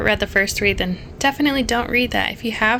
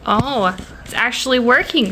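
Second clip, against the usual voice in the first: normal talking voice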